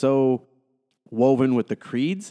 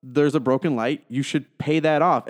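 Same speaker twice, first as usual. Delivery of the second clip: ordinary talk, nothing marked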